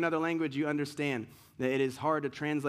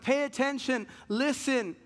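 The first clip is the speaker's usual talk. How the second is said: calling out; 7 semitones higher than usual. Retold or imitated. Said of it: "She's like".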